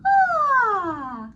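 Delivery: loud falling sighed ah; airy descending head-voice sigh; high head-voice sigh. Shown as a demonstration. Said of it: "by sighing".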